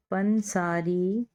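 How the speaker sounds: very slow, joining the syllables; slow blending. Shown as a demonstration. Teacher slowly blending syllables for students. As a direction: draw the word out as you blend it. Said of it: "पे नून पन".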